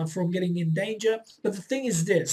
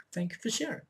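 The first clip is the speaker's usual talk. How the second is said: calm acceptance; 3 semitones higher, 8 dB quieter, faster than usual. Shown as a demonstration. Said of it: "and then you say".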